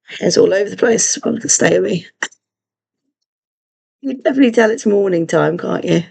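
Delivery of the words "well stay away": ordinary talk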